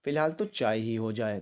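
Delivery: ordinary delivery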